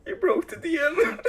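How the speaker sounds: high and loud through laughter; laughing hard. Real, not acted. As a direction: crack up while saying it. DM cracking up, laughing.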